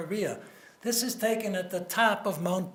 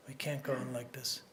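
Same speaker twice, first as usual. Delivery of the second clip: softer, lower echo of the line; quiet repeat; 7 semitones lower, 9 dB quieter than usual. Retold or imitated. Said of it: "he turned to his cabinet and he said".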